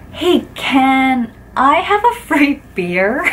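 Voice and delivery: slow, giggly awkward ask; giggly request. Retold or imitated. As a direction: ask sheepishly through a giggle. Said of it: "was giggling as I was saying it".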